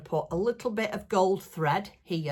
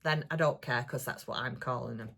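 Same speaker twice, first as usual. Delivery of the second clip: usual speaking voice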